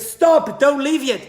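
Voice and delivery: high, loud urgent plea; a boy pleading with the rider to stay; calling out. Acted. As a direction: beg him not to go. Boy calling the man back.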